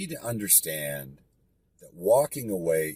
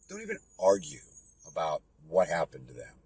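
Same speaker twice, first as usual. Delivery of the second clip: ordinary talk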